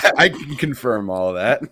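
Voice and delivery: laughing as he speaks. Real, not acted. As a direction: laugh through the line. Laughing along with the joke.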